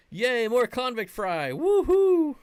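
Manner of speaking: high, loud sarcastic celebration; hammed-up yay into a shrieking woohoo; mock cheer. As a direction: cheer way too hard over something unwanted. Mock cheer over unwanted fry.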